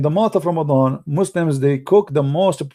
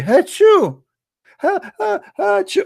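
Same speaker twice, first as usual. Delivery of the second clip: hammed-up sneeze, higher and louder; fake sneeze; 3 dB louder than usual. Chat room host sneezing for Allah, hammed-up sneeze. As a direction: sneeze big and theatrical, then laugh. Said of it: "Let us sneeze so Allah get happy".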